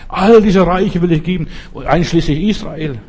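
high and loud boasting tempter; devil's offer. Retold or imitated. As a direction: shout the devil's grand offer.

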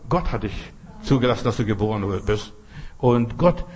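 normal talking voice

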